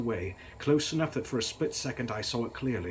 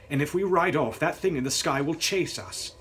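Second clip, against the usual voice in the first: stern; 5 semitones higher, 5 dB louder than usual. A narrator's reading. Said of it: "I said sternly".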